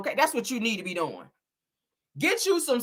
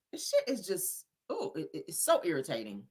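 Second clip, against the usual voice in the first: fed-up muttering; 4 semitones lower, 11 dB quieter than usual. Real, not acted.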